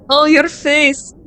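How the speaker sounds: loud delighted laughter, drawn-out words in between; laughing at them. Acted. Friend laughing at the listener's shocked face, loud and gleeful. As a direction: cackle at their shocked face.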